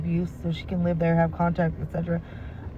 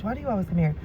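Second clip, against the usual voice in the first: ordinary delivery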